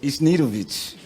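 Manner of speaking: Isnidovic, a laughing stab at Žnidaršič with the consonants lost; botched foreign name. Shown as a demonstration. Guest trying a Slovenian surname on request, mangled into Isnidovic. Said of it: "try to pronounce that last name".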